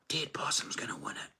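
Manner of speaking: hushed secret whisper. Retold or imitated. Father retells the son whispering the winner's name. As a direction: breathe it like a confident kid. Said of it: "he was whispering to me".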